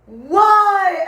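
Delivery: high, loud laughing wail; laughing, anguished protest; mock despair. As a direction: cry out to the heavens. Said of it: "I was like".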